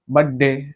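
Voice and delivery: "बर्थडे" reduced to "badde"; mispronounced birthday. Shown as a demonstration. Showing the common mistake, "बर्थडे" said as "badde". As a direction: say "बर्थडे" without its r and th. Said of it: "बर्थ ना बोलकर".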